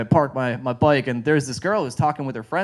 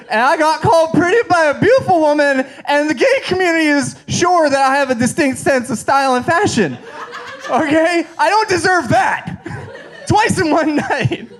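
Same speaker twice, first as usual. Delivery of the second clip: high-pitched, louder, indignant outburst through laughs; angry rant; 10 semitones higher, 3 dB louder than usual. Retold or imitated. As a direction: blow up in mock outrage, half laughing. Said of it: "I was angry at first".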